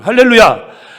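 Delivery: 크게 힘주어 할렐루야; 회중을 향해 크게 외치기. Real, not acted. (설교자가 회중에게 크게 외치는 할렐루야)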